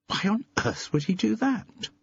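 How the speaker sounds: high, disbelieving question; can't believe it; Carol's high incredulous question. A narrator's reading.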